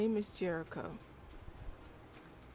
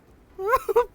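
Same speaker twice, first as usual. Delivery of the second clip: loud high laugh; 18 dB louder than usual. Real, not acted. Cracking up.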